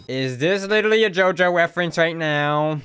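ordinary delivery

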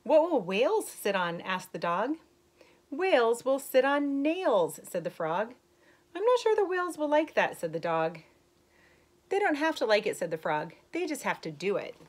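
story reading. Acted. Teacher reading a rhyming book to young kids, lilting. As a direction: read the rhyme brightly to the class. Reads picture book to children.

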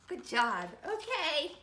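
ordinary delivery, nothing marked

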